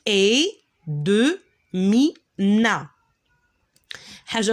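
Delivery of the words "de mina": "de mina" said slowly, "de" stressed with a clear open e; careful pronunciation. Shown as a demonstration. Teacher showing how to say the final e, slowly. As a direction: stretch out "de" like the letter name de. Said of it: "on doit le prononcer comme le de".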